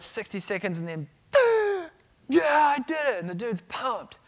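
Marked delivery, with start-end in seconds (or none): 1.3-1.9 huge gasp like surfacing from water; big gasp for air. Retold or imitated. Surfacing after holding breath. 2.3-3.2 loud, high cry of victory; triumphant shout. Retold or imitated. Triumph after breath hold.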